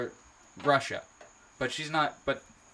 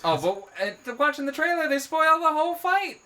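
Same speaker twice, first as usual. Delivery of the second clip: excited; 8 dB louder than usual. Real, not acted. Indignant about trailer spoilers.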